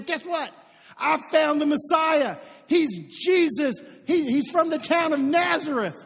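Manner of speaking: loud, high-pitched burst of excited news; all excited. Acted. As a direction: blurt out the big discovery, loud and high. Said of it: "And he's all excited".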